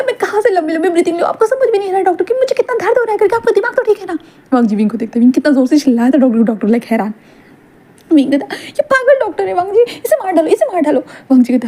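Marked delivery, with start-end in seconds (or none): 0.0-4.2 high furious labour rant. Acted. Wing yelling at doctor. 8.5-11.0 high frantic demand. Acted. Wing demands doctor be killed.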